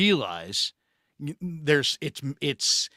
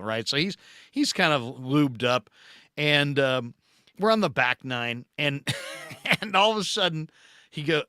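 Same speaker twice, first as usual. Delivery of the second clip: ordinary talk, nothing marked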